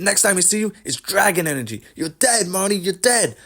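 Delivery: mock a ranting fighter in a high voice; Colby's mock screaming. Host mocking a fighter threatening his rival, high mock yell. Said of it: "Colby just screaming for no reason".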